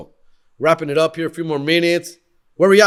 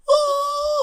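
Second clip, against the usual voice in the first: shocked gasp. Retold or imitated. Shock at winning.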